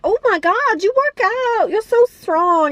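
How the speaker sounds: high, gushing mock swoon over a skinny man; gushing girls. Vlogger mimicking the girls fawning over Keanu, high gushing. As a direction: flutter up high in fake admiration. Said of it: "And they're like".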